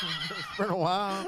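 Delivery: slow, stretched mocking echo of the line; mock drawl. Echoing the champion's line to the co-hosts, slow mocking drawl. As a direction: drag every word out to milk the joke. Co-host mocks the repeated line.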